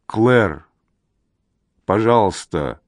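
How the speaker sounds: understated husky plea; lightly hoarse, pleading. A narrator's reading. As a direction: plead in a slightly hoarse, subdued voice. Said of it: "произносит он хрипло".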